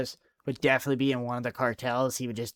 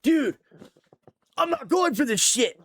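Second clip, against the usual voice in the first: hammed-up chill guy snapping; mock snap shout; 13 semitones higher than usual. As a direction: blow up like you've been pushed too far. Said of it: "react and be like".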